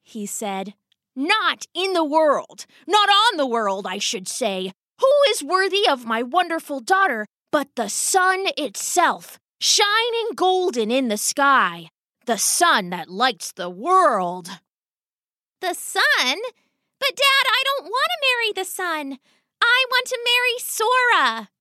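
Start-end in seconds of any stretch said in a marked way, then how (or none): 1.1-14.6 grandiose rat father. Acted. 15.6-21.5 put-on very high, dismayed girlish voice; protesting daughter. Daughter protests father's marriage plan.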